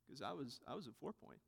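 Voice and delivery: very soft, low mock-modest mutter; imitated offhand brag, barely voiced; humblebrag. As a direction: slip the boast in under your breath. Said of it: "try to do a little bit better at it".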